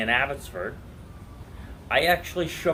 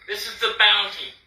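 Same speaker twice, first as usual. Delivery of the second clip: loud declaration; 8 semitones higher, 5 dB louder than usual. Real, not acted. Declaring to opponent.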